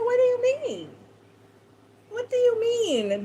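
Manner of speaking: baffled, voice shooting up; retold shocked disbelief, high. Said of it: "I said".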